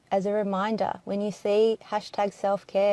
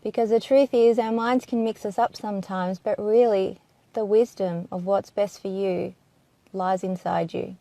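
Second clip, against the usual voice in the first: ordinary delivery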